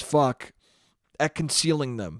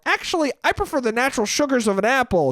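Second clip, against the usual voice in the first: high, quick, smug nerd voice; prissy know-it-all kid; 7 semitones higher, faster than usual. Acted. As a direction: sound like a little know-it-all correcting grown-ups. Mocking prim health-nut kid.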